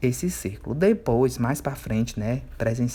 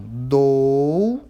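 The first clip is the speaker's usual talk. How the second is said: Dourado stretched slowly, a little louder; slow modelled word; 6 dB louder, much slower than usual. Shown as a demonstration. Teacher reads the example word to pupils, slow and clear. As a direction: draw out Dourado so pupils can split it. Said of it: "Aí você vai fazer a separação".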